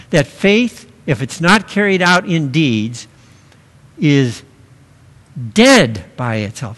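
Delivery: "faith" lifted, pause, then "dead by itself" weighted; emphatic ending. Shown as a demonstration. Preacher driving home James' point, stressing "dead by itself". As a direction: build to a heavy "dead by itself". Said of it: "to make it emphatic".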